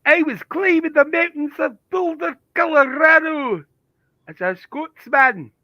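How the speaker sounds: high, loud, hammed-up Scottish accent; bad Scottish accent. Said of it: "worst Scottish accent I've ever heard".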